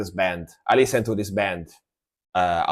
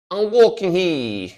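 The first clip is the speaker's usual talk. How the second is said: put-on New York accent, slow and higher, broken by laughter; laughing New Yorker accent; 8 semitones higher, 3 dB louder, slower than usual. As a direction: crack up while doing the New Yorker line. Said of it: "Like New, New Yorker".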